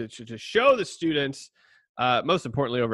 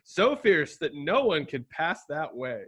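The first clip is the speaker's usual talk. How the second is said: higher-pitched, measured Bible reading; scripture reading; 5 semitones higher than usual. Acted. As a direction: read the passage aloud like a storyteller. Reads a scripture passage aloud.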